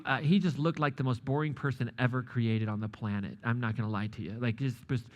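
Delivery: usual speaking voice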